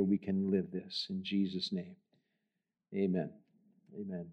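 soft, hushed prayer voice. Real, not acted. Pastor praying softly over his church. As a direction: pray quietly before the congregation.

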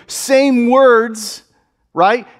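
normal talking voice

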